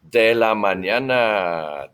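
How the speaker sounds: slow, stretched "De la mañana"; drawn-out model phrase. Shown as a demonstration. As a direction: draw out "mañana" for learners. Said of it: "So repitan".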